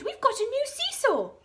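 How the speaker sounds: high, loud delighted cry; children thrilled by a new seesaw; excited children. A narrator's reading. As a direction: squeal with delight. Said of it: "they cried".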